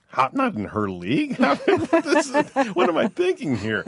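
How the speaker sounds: high, laughing self-mockery; laughing disbelief. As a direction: laugh through the question, bewildered. Husband retells his own disbelief.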